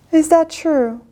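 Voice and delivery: ordinary delivery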